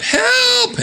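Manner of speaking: high, drawn-out cartoon cry; mock yell for help. Chrissy squashed at the door, high mock yell for help. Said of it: "Chrissy yells out".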